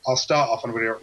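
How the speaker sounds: normal talking voice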